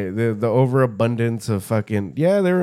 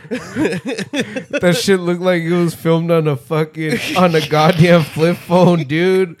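higher and louder through laughter; real laughing outrage; laughing disbelief; 7 semitones higher, 6 dB louder than usual. Real, not acted. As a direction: crack up at how bad it looked. Laughing at a shaky video.

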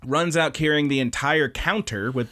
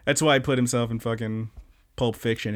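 usual speaking voice

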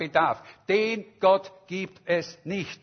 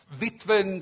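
ordinary delivery

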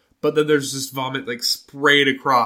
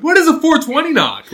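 much higher, much louder; fed-up kid asking again; exasperated question; 9 dB louder than usual. Retold or imitated. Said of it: "I'm like".

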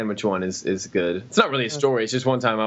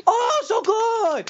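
high, slow mock Chinese accent; much slower than usual. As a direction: stretch oh so good very high.

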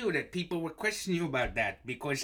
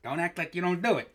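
ordinary talk, nothing marked

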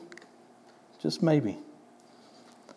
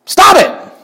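shouted order; 10 dB louder than usual. Shown as a demonstration. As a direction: Stop it shouted very high and loud. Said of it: "it just doesn't work".

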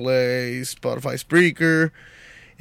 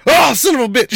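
sudden yelp of pain, higher and louder; pained yell; 10 semitones higher, 6 dB louder, faster than usual. Retold or imitated. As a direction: yell like you just stubbed your toe. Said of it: "when he stubs his toe".

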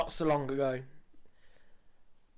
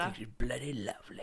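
mocking whisper; 4 semitones lower, 9 dB quieter, faster than usual. Mocking her line in a whisper.

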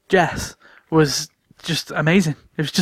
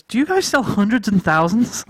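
lightly imitated, higher-pitched question; higher, lightly mimicked visitor; quoting the visitor; 6 semitones higher than usual. Visitor asking shop staff for sprinkles, lightly imitated question. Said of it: "she turns around to me and she goes".

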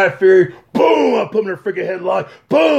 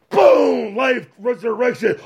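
ordinary delivery

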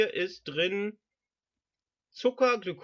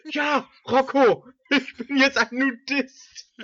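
louder, higher proud line; character voice; 7 semitones higher, 7 dB louder than usual. Acted. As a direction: announce it proudly.

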